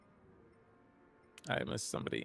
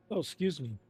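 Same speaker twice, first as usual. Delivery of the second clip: choking; 6 dB quieter, faster than usual. Real, not acted. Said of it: "Something was in my choking there".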